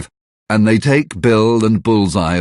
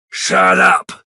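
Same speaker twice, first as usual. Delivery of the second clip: angry shout; 11 semitones higher than usual. Acted. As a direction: silence him with force. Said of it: "shouts Bill".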